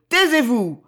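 loud, high yell; vociferated command. A narrator's reading. Sorcerer silencing the servant, furious yell. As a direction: bellow it to cut him off. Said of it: "vociféra le nain haletant".

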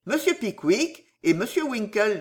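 usual speaking voice

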